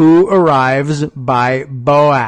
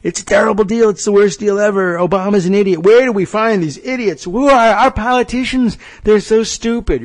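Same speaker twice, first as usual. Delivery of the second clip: lightly hammed-up Trump rant, pitched a bit higher; imitating Trump; 6 semitones higher than usual. Host mimicking Trump ranting about deals. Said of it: "he's going on and on".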